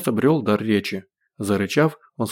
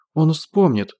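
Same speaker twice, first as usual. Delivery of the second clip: higher, insistent cry; 6 semitones higher, 3 dB louder, slower than usual. A narrator's reading. Olga insists to Boris he will remember, crying out. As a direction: call it out as a desperate insistence. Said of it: "крикнула Ольга".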